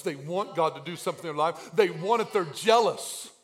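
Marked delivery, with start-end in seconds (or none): 1.7-3.3 building, louder and higher preaching; preaching at full force. Acted. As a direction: drive it home, rising in intensity.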